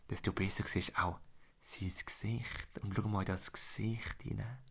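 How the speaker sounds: hushed secret whisper. Acted. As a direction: draw them in with a whisper. Approaching the hero's face.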